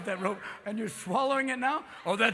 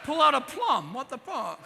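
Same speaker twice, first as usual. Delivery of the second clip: slow, stammering drawl; halting aw-shucks leading man; hammed-up Jimmy Stewart stutter; 4 semitones higher, slower than usual. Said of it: "Jimmy Stewart".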